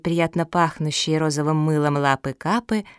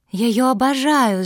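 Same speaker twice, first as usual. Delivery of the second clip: high, loud burst of adoration; youthful passionate outcry; fervent exclamation; 7 semitones higher, 5 dB louder than usual. A narrator's reading. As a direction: declare it ardently. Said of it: "с жаром воскликнула дворняжка".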